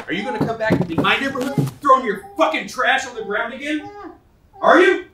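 loud, high-pitched demand building to a shout; angry interrogation. Acted. Neighbor confronting a litterer over trash thrown on the ground, angry. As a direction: intimidate him, raising pitch and volume to the last word.

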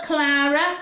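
ordinary delivery

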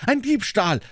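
high-pitched, lightly breathless exclamation; panting outburst. A narrator's reading. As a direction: blurt it out as if panting. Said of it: "stieß ich keuchend heraus". (leicht keuchend hervorgestoßen, hohe Stimme)

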